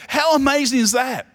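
high, wondering "How amazing is that?"; amazed exclamation. Retold or imitated. Pastor marvels to the church at the butterfly, amazed. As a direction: sound truly amazed. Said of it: "And I think to myself".

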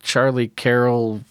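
normal talking voice

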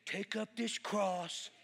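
slow, soft put-on voice; mocking imitation. Seatmate quotes red letters at the preacher, mocking. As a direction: mimic him mocking the scripture, drawn out. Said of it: "he'd do like this".

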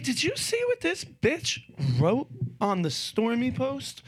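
hint of a shocked friend; high indignant gossip. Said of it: "She's like".

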